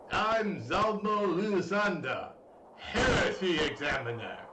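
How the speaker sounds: slow, solemn self-introduction; stern inquisitor. Acted. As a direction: announce yourself with menace. Villain introduces himself.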